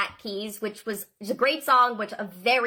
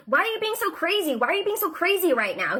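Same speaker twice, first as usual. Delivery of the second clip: mock yell; 8 semitones higher than usual. Narrator imitates the man yelling at her in the parking lot, high mock shout. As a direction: parrot his scream with the pitch pushed way up. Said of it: "he's screaming at me".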